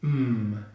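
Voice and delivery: held "Mm" hum, lips together; nasal M. Shown as a demonstration. Teacher showing the consonant sound, nasal "Mm". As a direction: hum "Mm" through the nose. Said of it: "push the air through the nose".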